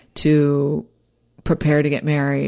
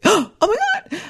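a sharp gasp into a high, breathy Oh my God; sudden alarm about the makeup artist not showing; mock panic. Bride at 3 a.m., suddenly panicking about the makeup artist.

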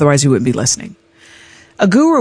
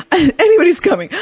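laughing; 13 semitones higher, 3 dB louder than usual. Real, not acted. Desperate relief, laughing.

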